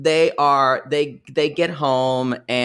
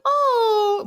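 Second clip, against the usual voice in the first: very high, appalled mother; snooty poodle voice. Retold or imitated. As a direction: fake delight, pitch way up. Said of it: "my mother's voice, when Mother Poodle's voice went really high".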